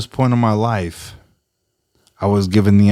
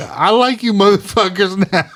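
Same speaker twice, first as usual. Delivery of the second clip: excited cheer; 9 semitones higher than usual. Retold or imitated. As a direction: cheer them on with glee. Cheering the neighbors' song choice.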